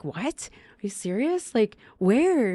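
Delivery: shocked. Retold or imitated. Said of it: "I'm like".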